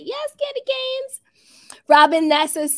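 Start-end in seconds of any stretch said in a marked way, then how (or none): 0.0-1.2 high greeting. Real, not acted. Greets a viewer.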